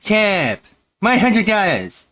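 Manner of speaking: high loud mocking whine; mock squeal. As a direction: echo it in a shrill mock voice. Mocking the tip amount.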